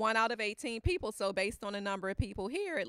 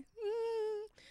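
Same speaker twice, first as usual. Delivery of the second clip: put-on high, small child's hum; child's whimper; 12 semitones higher, 4 dB quieter than usual. Grandson admitting it, a small high whimper. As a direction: whimper like a guilty little boy. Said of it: "he's like".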